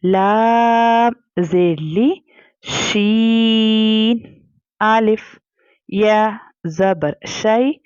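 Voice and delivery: drawn-out letter-by-letter Quranic spelling; slow spelling recitation. Shown as a demonstration. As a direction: chant each letter and vowel slowly. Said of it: "सूरह कहफ में आयत".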